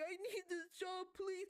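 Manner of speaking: half-acted whimpering appeal; mock crying plea. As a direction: imitate a tearful worker pleading. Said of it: "sitting there crying, like".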